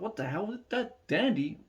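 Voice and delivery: high-pitched, incredulous retelling; excited mock astonishment; amazed reaction. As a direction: act out disbelief at hearing your own area. Retelling amazement at hearing hometown.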